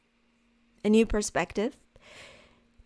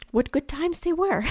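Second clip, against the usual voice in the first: nostalgic quote; 7 semitones higher than usual. Retold or imitated. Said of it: "they're like".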